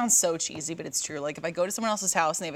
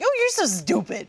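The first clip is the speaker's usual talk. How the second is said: sweet dog-insult voice; 10 semitones higher, 3 dB louder than usual. Retold or imitated.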